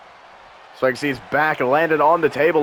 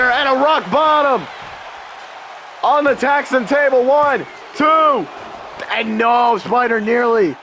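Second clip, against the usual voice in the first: excited; 6 semitones higher, slower than usual. Real, not acted. Big slam and pin.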